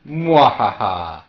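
drawn-out villain cackle; a slow gloating evil laugh. Pretend. Boasts of evil hacking.

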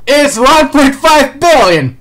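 loud, high and slow through laughter; overjoyed, drawn-out exclamation; elated shout. Real, not acted. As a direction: shout the figure out, thrilled and laughing. Celebrates the huge bounty figure.

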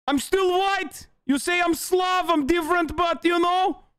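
high, dopey put-on voice of a clueless fan; mocking idiot voice. Streamer voicing a clueless far-right chatter in a high, dopey voice. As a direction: play him as a proud fool.

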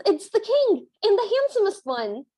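high, mock-excited gushing; hammed-up thrill at seeing royalty; starstruck stepmother. Stepmother spotting the king and the handsome one, mock-starstruck.

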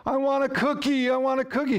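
put-on high, pleading little-kid voice; whiny toddler. Toddler in the cart begging parent for a cookie, high and whiny. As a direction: beg like a three-year-old in the store. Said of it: "what does the kid do?".

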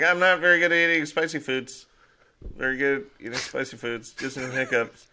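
high, hurried mock excuse; imagined spicy-food sufferer. Guest excusing himself over spicy food, high mock-distressed voice. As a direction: act out struggling through spicy food. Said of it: "I would just spend the whole time saying".

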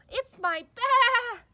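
high, loud, stretched-out cry of joy; the lamb just heard his voice, thrilled; excited discovery. Acted. Lamb realizing his lost Baa is near, excited high cry.

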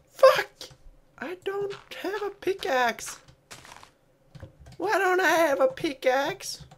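real gamer rage in a squeaky high voice; frustrated squeal. Real, not acted. Gaming frustration outburst.

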